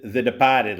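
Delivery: demonstrating a Boston accent, R dropped in Departed; "De-pah-ted" in a Boston accent. Shown as a demonstration. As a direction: show off the Boston way of saying it. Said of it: "the Boston accent".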